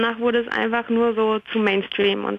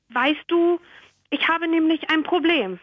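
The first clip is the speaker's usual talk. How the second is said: put-on higher girl's voice with a different timbre; teenage girl; 6 semitones higher than usual. Teenage girl confides her problem to the lion in a higher voice.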